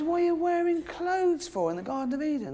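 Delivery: high-pitched comic outrage; mock furious God. God confronts Adam and Eve about clothes, mock furious. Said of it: "He's furious".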